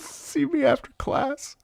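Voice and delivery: high, slow, squeezed through laughter; cracking up. Retold or imitated. Retelling teacher's summons to a pupil, high and helpless with laughter. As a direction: barely get the teacher's line out for laughing.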